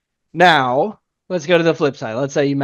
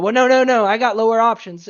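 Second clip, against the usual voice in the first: higher and louder, a rep rushing to save the sale; panicked salesman; 8 semitones higher, 7 dB louder than usual. Retold or imitated. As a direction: blurt it out in a panic. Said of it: "Instead of going".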